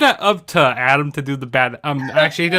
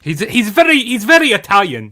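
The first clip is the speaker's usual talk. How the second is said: lightly attempted Italian accent, higher and louder; 8 semitones higher, 7 dB louder than usual. Failing at an Italian accent for a wrestler, hammed-up Italian accent. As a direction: try an Italian accent and fall short. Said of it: "I can't get an Italian accent".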